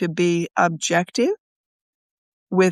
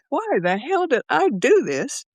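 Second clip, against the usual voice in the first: higher, half-mock panicked cry; retold terror; 5 semitones higher than usual. To herself in free fall, mock terrified. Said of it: "I am freaking terrified".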